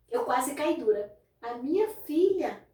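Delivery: usual speaking voice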